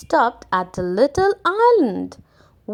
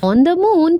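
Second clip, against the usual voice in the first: usual speaking voice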